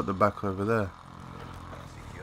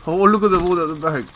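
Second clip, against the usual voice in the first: excited; 7 semitones higher, 7 dB louder, faster than usual. Real, not acted. Excited spotting enemies in game.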